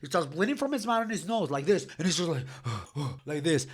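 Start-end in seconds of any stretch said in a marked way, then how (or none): 2.5-3.2 soft, low gasp for air; imitated gasp. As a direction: gasp quietly like a beaten man. Bloodied opponent gasping.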